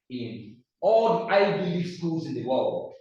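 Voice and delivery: normal talking voice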